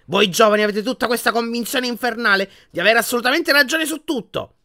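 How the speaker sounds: high, loud, scathing tirade; angry shouting. A narrator's reading. Portrait berating the conceited boy Harry, angry shouting. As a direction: berate him at full voice, drawing out the insults. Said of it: "urlò ancora più forte Phineas Nigellus".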